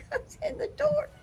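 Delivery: words breaking up with high laughter; real giggle while telling the story; laughing. Real, not acted. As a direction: laugh through it, squeaking high. Laughing at the dogs running in.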